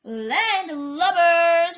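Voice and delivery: loud, drawn-out pirate roar; hammed-up pirate insult. A narrator's reading. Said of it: "roared Pirate Minnie".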